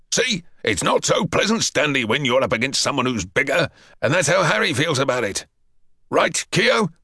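pointed PT master reprimand; scolding sergeant. Acted. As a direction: teach the bully a lesson. Sergeant lectures the bully.

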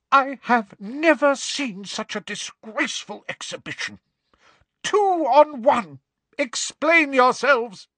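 high-pitched outraged telling-off; livid scolding. Acted. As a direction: demand an explanation in fury. Livid professor scolds two pupils.

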